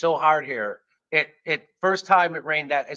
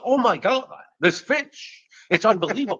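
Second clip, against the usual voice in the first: high-pitched mock amazement; Shatner; 8 semitones higher, 4 dB louder than usual. Diner raving to the storyteller over a bite, amazed. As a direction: gush over it in a high, awestruck voice. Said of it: "He would just look at me".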